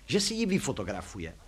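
ordinary delivery

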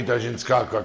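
ordinary talk

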